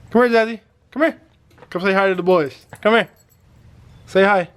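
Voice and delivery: high coaxing puppy call; puppy talk. Real, not acted. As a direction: coax the dog over sweetly. Calling the puppy over.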